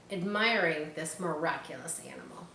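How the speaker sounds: usual speaking voice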